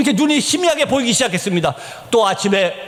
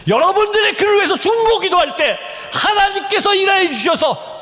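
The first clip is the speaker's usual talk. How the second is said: high, ringing proclamation; impassioned preaching; 11 semitones higher than usual. Real, not acted. Preacher promising God works through intercession, impassioned. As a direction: proclaim it with full conviction.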